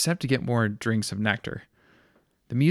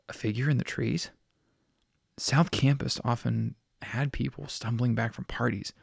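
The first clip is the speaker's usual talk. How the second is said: usual speaking voice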